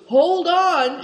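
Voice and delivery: high, loud shout; shouting through thick dusty air. A narrator's reading. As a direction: yell it to reach him. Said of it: "shouted Moonlight".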